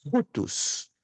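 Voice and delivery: "Rutus" as HHOO-tus, audible breath first; breathy h opening on "Rutus", stressed OO; rough breathing. Shown as a demonstration. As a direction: aspirate the start of "Rutus" strongly. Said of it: "memorizar essa pronúncia".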